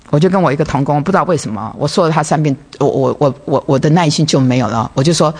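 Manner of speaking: ordinary delivery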